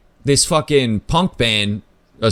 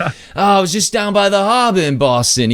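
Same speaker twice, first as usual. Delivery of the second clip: higher, playful put-on Boston accent; 8 semitones higher than usual. Answering a Boston stranger in his own Boston accent, mock. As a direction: tease him back in a thick Boston accent. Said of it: "so I start talking in one".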